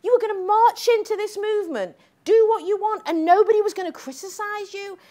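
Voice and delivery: high, loud indignation; heated. Retold or imitated. Speaker still heated about the organiser, loud high indignation. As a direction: keep the fire up.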